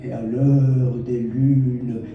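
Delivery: measured verse with long pauses between phrases; poetry recital. Acted. Poet reciting verses for a child of the Sahel, poetry recital. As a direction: recite lyrically, lingering on the line endings.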